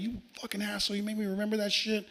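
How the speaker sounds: high-pitched, softened retold yelling; mild mock yell. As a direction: accuse him in a held-back yell. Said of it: "went out and started yelling".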